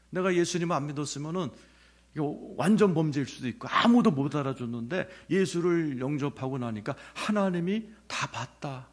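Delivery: ordinary delivery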